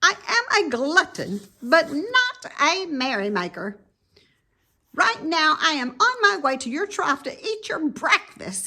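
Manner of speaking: high, quick, sour snap; sour rat. Acted. Templeton refusing Wilbur, sour and quick. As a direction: sneer it out fast. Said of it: "replied the rat sourly".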